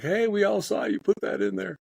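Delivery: high, pointed call-out. Retold or imitated. Confronting a shoplifter, high and accusing. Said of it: "I'm like".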